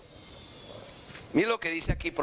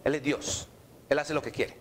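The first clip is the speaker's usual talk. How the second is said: ordinary delivery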